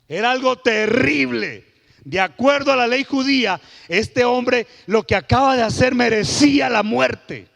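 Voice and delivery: high, loud fiery preaching; preaching at full force. Acted. Pastor booming at the church about the blasphemy, loud. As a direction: thunder it out to the congregation, slowly on the key lines.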